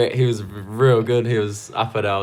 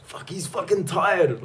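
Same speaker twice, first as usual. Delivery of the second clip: imitated yelling; 8 semitones higher, slower than usual. Recalling a cornerman's first-round yelling, high restrained mock yell. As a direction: mimic a distant holler at a raised pitch. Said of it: "like, him yelling".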